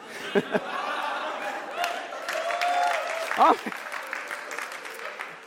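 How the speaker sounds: a high, breathy burst of laughter at himself; sudden high laugh; real laugh. Real, not acted. Preacher laughs at his own mistake.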